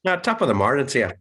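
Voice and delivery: fast, laughing Irish accent put on as a joke. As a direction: fire off a jokey Irish greeting in an Irish accent. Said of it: "like a Newcastle accent".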